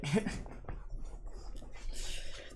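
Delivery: real breathy laughing fit; cracking up at herself. Real, not acted. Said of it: "Oh, I'm dying".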